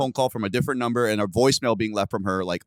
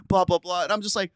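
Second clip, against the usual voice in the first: ordinary delivery, nothing marked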